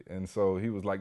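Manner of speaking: normal talking voice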